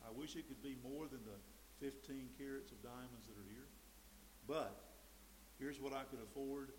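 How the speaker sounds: soft, loving husband offering a gift; tender husband. Retold or imitated. Husband handing wife a Valentine's gift, soft and tender. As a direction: say it gently to your wife.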